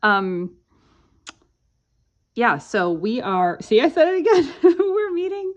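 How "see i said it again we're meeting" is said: laughing through the words. Real, not acted. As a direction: giggle while talking. Laughs at her own habit.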